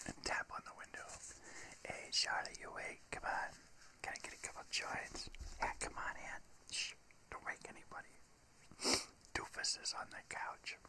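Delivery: whispered sneaking in; sneaking in late. Retold or imitated. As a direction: hush it like you're sneaking in. Sneaking in to friend's house.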